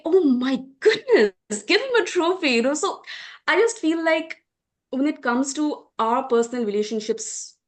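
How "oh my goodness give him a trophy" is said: sarcastic, over-the-top "oh my goodness", slowed and higher; mock gushing praise. Speaker mocking the praise sons get for cooking, hammed-up sarcastic gushing. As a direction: gush like a proud aunt, dripping with irony.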